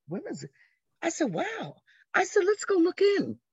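high-pitched, hushed wonder rising to eagerness; retold surprise. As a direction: replay the amazed discovery, pitched high. Retelling her surprised discovery.

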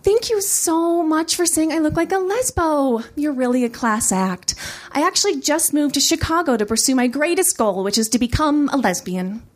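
sugar-coat the sarcasm for a heckler; syrupy stage politeness; light and sweet. Acted. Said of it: "my voice light and sweet".

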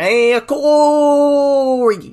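very high, loud, hugely stretched "Hey, Corey"; long, sing-song gushing hello in a put-on voice; mock Shaq greeting. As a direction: drag the greeting out slowly like a starstruck fan. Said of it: "That's how he says it".

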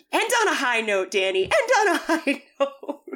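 high-pitched urging, breaking into laughter; playful fan encouragement; cheering on. Acted. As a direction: egg a performer on from the crowd. Viewer urging Danny on, laughing.

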